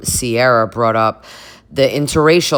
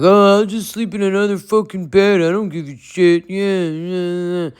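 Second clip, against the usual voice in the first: imitating Kyle; 6 semitones higher than usual. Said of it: "Kyle's like".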